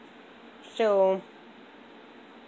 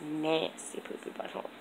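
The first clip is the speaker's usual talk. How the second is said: disgusted mutter; 4 semitones lower, 9 dB quieter than usual. Real, not acted. Repeated disgust, dropping to whisper.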